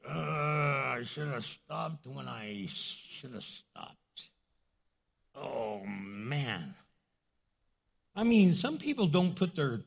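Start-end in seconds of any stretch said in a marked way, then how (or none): 0.1-6.8 low, slow regretful groan; acted regret. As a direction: sink into a drawn-out, quieter "oh man" of regret. Acting regret after saying too much.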